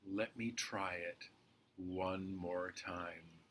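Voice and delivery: soft, low, slow thought; quiet resolve. Acted. Head gearing up to retry, quiet resolve. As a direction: drop right down, thinking to oneself.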